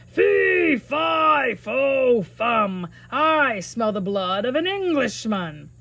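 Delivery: loud shouted giant chant; giant yelling. Acted. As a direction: bellow it like a hungry giant.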